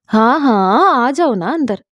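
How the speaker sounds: sweetly high, syrupy invitation; sugary welcome. Acted. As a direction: turn suddenly honey-sweet to the guest. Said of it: "लहजे में चाशनी घुल गई". (चाशनी जैसी मीठी आवाज़ में बुलाना)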